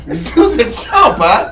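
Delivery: normal talking voice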